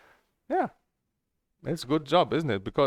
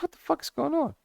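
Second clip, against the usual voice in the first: much higher, mock-exasperated squeal; retold outburst. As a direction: shriek it in mock disbelief. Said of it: "I said".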